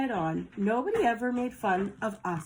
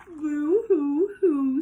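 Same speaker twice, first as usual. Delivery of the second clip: high, slow boo-hoo sob, louder; mock sobbing; 7 semitones higher, 5 dB louder, much slower than usual. Scared dogs sobbing to the monster, hammed-up crying. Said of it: "sobbed the dogs".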